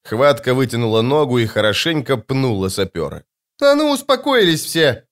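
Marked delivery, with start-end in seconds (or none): 3.6-5.0 high, sharp command to the squad; barked order. A narrator's reading. Corporal calling the squad to order, barking. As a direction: bark it at everyone. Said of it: "рявкнула она".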